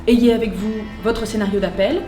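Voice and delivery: ordinary delivery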